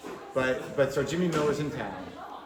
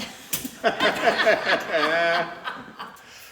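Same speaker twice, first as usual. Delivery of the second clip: high, loud laughing fit; loud burst of laughter; 4 semitones higher, 5 dB louder than usual. Real, not acted. Comic cracking up at his own punchline, loud laughter. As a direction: break up laughing.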